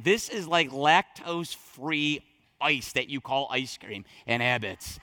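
scornful drawn-out comparison. Real, not acted. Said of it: "mocking comparison".

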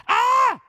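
high, loud cry of panic; desperate scream. Retold or imitated. Jehoshaphat crying out in battle, high loud scream. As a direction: let out a sudden desperate yell. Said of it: "He screams".

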